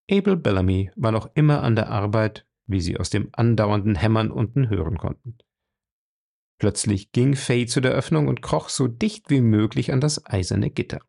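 ordinary delivery, nothing marked

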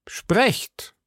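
high, urging call. A narrator's reading. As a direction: urge it out, pitched well up. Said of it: "riefen die Musketiere". (hoher, drängender Zuruf)

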